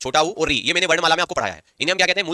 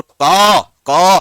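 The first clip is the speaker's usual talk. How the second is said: का held long and loud; long drawn-out का; 9 dB louder, much slower than usual. Shown as a demonstration. Teacher showing a guru matra, का loudly prolonged. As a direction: stretch the vowel of का, louder. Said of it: "उच्चारण में समय ज्यादा लगा".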